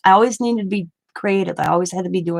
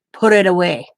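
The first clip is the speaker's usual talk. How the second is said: firm, louder command, lightly voiced old man; a stern order that ends the argument; stern grandfather; 6 dB louder than usual. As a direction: grandpa shutting down the doctor, stern and loud. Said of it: "He'd have that tone".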